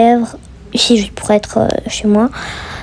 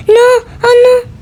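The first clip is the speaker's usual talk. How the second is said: sudden high yelp of dismay; high dismayed cry; 11 semitones higher than usual. Real, not acted. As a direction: squeak out your shock. Stuck on a question. (petit cri aigu de détresse)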